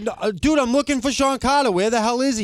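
high put-on voice; pushy prank caller. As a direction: ham up the insistent caller. Caller demanding [name].

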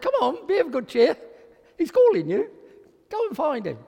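high-pitched, cheering him on; acted crowd's excited encouragement; eager encouragement. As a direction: urge him along brightly, voice lifted. Crowd now cheers the blind man on.

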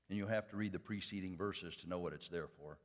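ordinary talk

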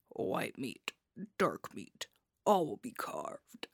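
low, hushed trailer narrator; horror trailer voice-over. Retold or imitated. Host quotes a spoof horror film trailer, in a deep trailer voice. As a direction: drop deep and soft, savoring the menace.

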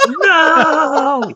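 a high, laughing "no" in his friend's voice; mock despair through a laugh; exasperated friend. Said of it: "And he's like".

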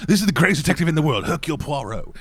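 quick, badly faked French accent; hurried introduction in a rough French accent; attempted French accent. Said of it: "I can't do a French accent".

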